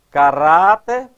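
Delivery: "caráter" with a loud, drawn-out RÁ; stress on RÁ. Shown as a demonstration. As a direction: punch the middle syllable of "caráter". ("caráter" com ênfase forte no RÁ)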